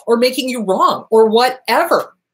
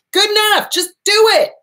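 emphatic; 11 semitones higher, 5 dB louder than usual. Real, not acted.

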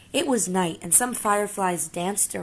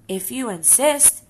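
higher, slow, sugary reply; sweetly; 5 semitones higher, slower than usual. Acted. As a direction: answer with calm, sweet confidence. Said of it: "answered the friendly ladybug sweetly".

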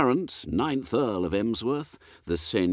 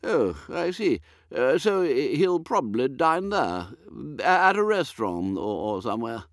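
put-on high, dithering old lord, higher and fluttery; a woolly, absent-minded aristocrat, higher pitched; vague old earl; 6 semitones higher than usual. Lord puzzling over dinner with his butler, high and dithery.

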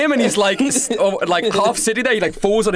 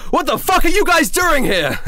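higher, angry, fist on the table; angry demand; 7 semitones higher than usual. Acted. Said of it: "slams his fist into the table and goes".

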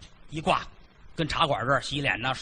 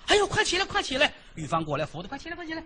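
put-on high-pitched fussing old woman; the mother-in-law helps him up, high and flustered; alarmed old mother-in-law; 11 semitones higher than usual.

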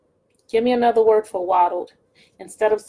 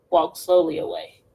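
plain reading voice

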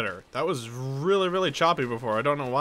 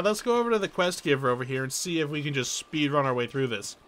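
ordinary delivery

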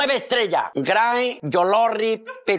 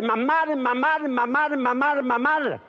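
mock-tearful, higher repeated cries for mother; llamando llorando; 3 semitones higher, slower than usual. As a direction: call for mum over and over, pretending to cry. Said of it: "¿Cómo estás llorando?". (llanto fingido llamando a mamá)

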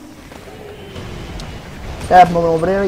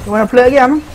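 cocky gamer trash talk, pitched up; taunting; 7 semitones higher, faster than usual. Real, not acted. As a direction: rub it in his face, jeering. Taunting an enemy player in game.

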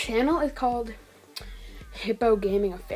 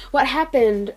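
louder retake; 3 semitones higher, 7 dB louder, slower than usual. Real, not acted. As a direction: say it again, louder and brighter. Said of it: "gonna cut that out".

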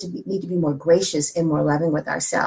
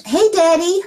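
delighted surprise; 10 semitones higher, 6 dB louder than usual. Real, not acted. Greets her father who's listening.

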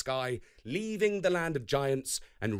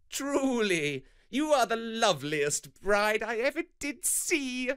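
higher, mock-admiring Loki; Loki swearing; 9 semitones higher than usual. A narrator's reading. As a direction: Loki teasing the dressed-up Thor. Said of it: "swore Loki".

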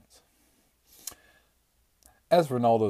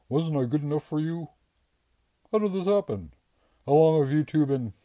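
much higher, louder anguished wail; betrayed strongman pleading with Rosie; 9 semitones higher, 7 dB louder than usual. A narrator's reading. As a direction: let the voice crack up high in despair. Bradley's heartbroken wail continues.

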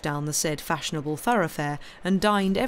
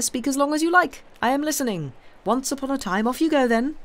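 teasing, higher and louder; mocking invitation; 9 semitones higher, 4 dB louder than usual. A narrator's reading. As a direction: goad the host to begin his tale. Albert mocks the innkeeper.

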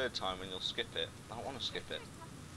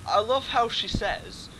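high, louder and slow; mock outrage; 11 semitones higher, 10 dB louder, slower than usual. Gamer mocks the tutorial text, slow high mock outrage. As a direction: build up the mock indignation.

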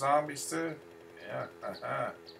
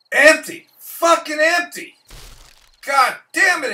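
much higher, loud yelling; furious shout; 14 semitones higher, 13 dB louder than usual. Real, not acted. Gamer raging at finding the shop empty, real furious shout. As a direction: explode at the empty crate.

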